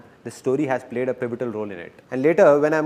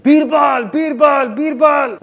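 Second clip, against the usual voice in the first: high, loud frantic name-calling; shouting and screaming; 11 semitones higher, 7 dB louder, slower than usual. Acted. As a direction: cry out for someone far away, high and loud. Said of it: "Shouting and screaming".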